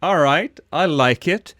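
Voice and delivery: ordinary delivery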